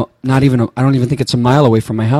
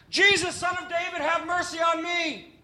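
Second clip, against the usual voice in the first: crying out; 14 dB quieter than usual. Acted. Blind man at the roadside calling to Jesus, crying out. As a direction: call out desperately from far off, voice way up. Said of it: "And he just keeps yelling".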